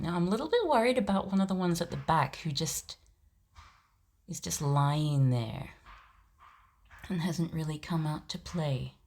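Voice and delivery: usual speaking voice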